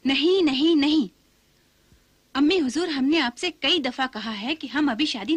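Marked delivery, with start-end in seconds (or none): 0.1-1.1 loud, emphatic refusal; ज़ोरदार इनकार. Acted. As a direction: cry out a firm no, higher and louder. Daughter angrily refuses marriage proposal. (ज़ोर से झुँझलाकर इनकार)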